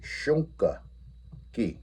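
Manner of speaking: drawn out "Shonkaki", every syllable stretched; breaking the Lakota word down slowly for students. Shown as a demonstration. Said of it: "if we break that down".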